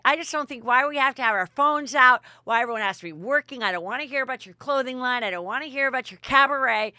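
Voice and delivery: high, loud, fast mock complaining; exaggerated fed-up tirade; bitter rant, imitated. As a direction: act out a bitter, rapid-fire gripe. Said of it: "very bitter".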